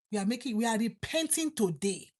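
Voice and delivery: normal talking voice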